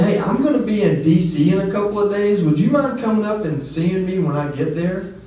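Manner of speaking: recreating an eager, high-pitched request; retold eager ask. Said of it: "what tone of voice I used".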